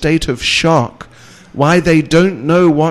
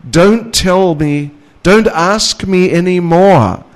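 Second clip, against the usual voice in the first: raised, louder mock protest; acted insistent plea; 5 semitones higher, 5 dB louder than usual. Lecturer voices a devotee begging no more questions, insistent. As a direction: plead firmly, pushing pitch and volume up.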